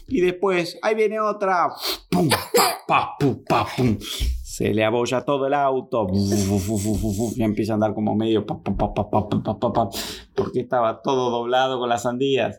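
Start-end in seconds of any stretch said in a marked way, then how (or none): 2.3-4.0 banging noises. Acted. Watermelons denting car. 8.5-9.9 sputtering engine. Acted. Battered car sputtering.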